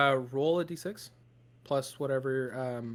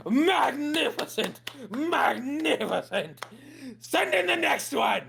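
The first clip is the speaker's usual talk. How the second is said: high, slow booming king voice; slow, lofty royal proclamation, pitched way up; Bullywog King; 10 semitones higher, much slower than usual. Acted. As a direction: drag it out like a grand amphibian king.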